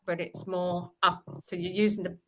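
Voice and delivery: ordinary talk, nothing marked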